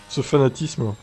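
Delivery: normal talking voice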